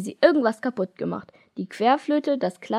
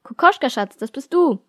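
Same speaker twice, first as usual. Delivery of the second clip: higher, louder motherly call; excited mother calling across the house; eager call; 6 semitones higher, 6 dB louder than usual. Acted. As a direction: call out brightly to her daughter.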